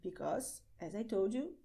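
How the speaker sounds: normal talking voice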